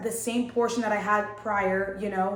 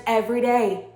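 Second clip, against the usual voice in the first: louder snap of a retort; retold yell; 8 dB louder than usual. Answering the nurse's questions, yelling back louder. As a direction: fire it back at her, louder. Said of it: "I was yelling at her".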